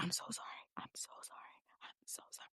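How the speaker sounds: whispered, barely voiced sorry; mouthing an apology. Mouthing sorry to the waiter behind her date, whispered. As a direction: mouth the apology silently across the room. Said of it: "mouthing to the waiter".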